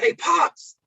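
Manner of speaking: high, bright call across the house; calling out to dad. Retold or imitated. Son calling to his dad about the leak, high call. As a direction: call out to your father from another room. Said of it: "So I called to my dad".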